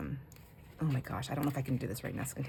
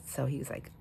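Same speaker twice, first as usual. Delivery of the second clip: ordinary talk, nothing marked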